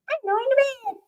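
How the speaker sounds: very high put-on pet voice; Marty. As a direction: pitch it way up as the little pet. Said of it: "that cannot be Marty's voice".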